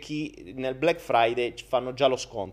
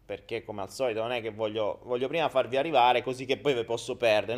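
normal talking voice

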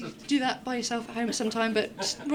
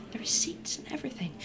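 whisper; 9 dB quieter than usual. Real, not acted. Aside to latecomers.

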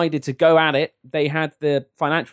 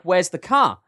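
higher-pitched English accent; 5 semitones higher than usual. Shown as a demonstration. Guest compares how the English say car, English accent. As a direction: show the English version. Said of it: "whereas in England we're like".

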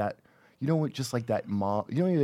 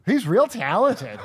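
high, loud airheaded mom; ditzy mom voice; 8 semitones higher, 5 dB louder, slower than usual. Retold or imitated. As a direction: play his scatterbrained mother. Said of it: "Your mother does not sound like that".

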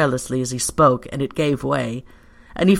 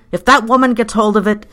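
high, loud anxious warning; panting agitation; 6 semitones higher, 6 dB louder than usual. A narrator's reading. Pyecraft fears the woman finding out, agitated warning. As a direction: blurt it out in alarm. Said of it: "He panted and gesticulated".

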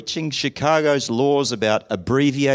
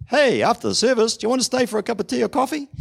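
bright, quick welcoming offer, pitched up; cheery invite; 11 semitones higher than usual. Retold or imitated. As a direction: greet newcomers like an eager host. Acted friendly church invite.

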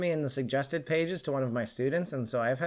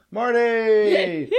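loud, higher, drawn-out cheer of a name; Cheers audience greeting; 8 semitones higher, 9 dB louder than usual. Acted. Host imitating the Cheers audience, cheering shout. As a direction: call it out like a crowd welcoming a regular. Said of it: "like, the Cheers audience".

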